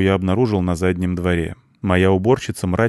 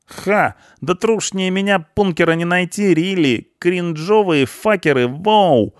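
high, loud cheeky punk voice; 12 semitones higher, 4 dB louder, slower than usual. A narrator's reading. Punk mouthing off at the cleaner, cocky. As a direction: fire off slang in a high, excited voice.

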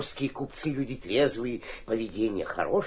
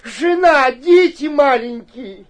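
desperate pleading; 12 semitones higher, 9 dB louder than usual. Acted. Mayor begs for mercy.

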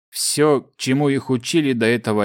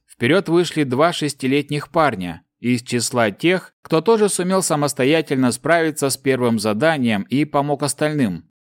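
ordinary delivery

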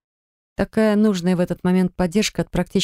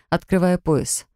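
ordinary delivery

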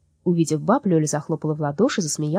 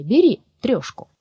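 slow, louder insistence; pushy offer; 6 semitones higher, 6 dB louder, slower than usual. Acted. Soul pressing three roubles on Lyolya, slow and insistent. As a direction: push the money at her. Soul pushing money on the girl.

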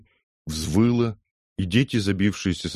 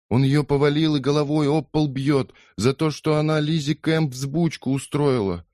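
frightened explanation; 10 semitones higher than usual. Acted. Daughter explains the beating.

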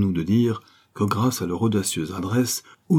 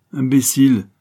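louder, higher scornful sneer; 5 semitones higher, 7 dB louder than usual. A narrator's reading. Diana sneering at her frightened lover. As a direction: scoff at his fear. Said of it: "ricana l'ex-institutrice".

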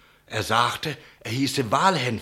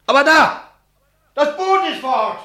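much higher and louder, sudden alarm; alarmed shout; 13 semitones higher, 10 dB louder than usual. Acted. Innkeeper to the captain, shouting in alarm at the empty mooring. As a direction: cry out as you spot it. Innkeeper spots the missing boat.

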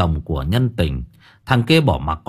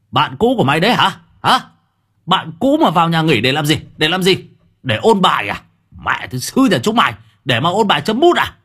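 higher, louder, fast furious interrogation; raised voice; 6 semitones higher, 3 dB louder, faster than usual. A narrator's reading. Husband to wife in the yard, shouting sarcasm. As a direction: hurl sarcastic questions, shouting. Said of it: "nó đã cao giọng".

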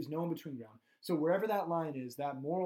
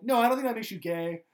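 mock reassuring friend; 6 semitones higher, 9 dB louder than usual. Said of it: "one of us would be like".

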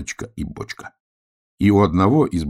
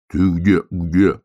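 louder hollow-barrel call; droning barrel; 6 dB louder, much slower than usual. A narrator's reading. Bear cub looking for hedgehog from barrel, booming. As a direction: boom the question out, at usual pitch. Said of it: "гудела бочка".

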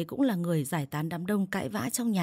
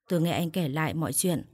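plain reading voice

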